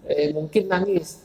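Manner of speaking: usual speaking voice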